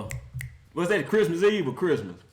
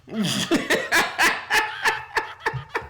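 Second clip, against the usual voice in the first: high cackling laugh; 10 semitones higher than usual. Real, not acted. Mocking the Browns.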